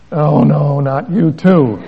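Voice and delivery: loud, slow mock dismay; dismayed groan. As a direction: wail it in comic disbelief. Dismay as wife falls.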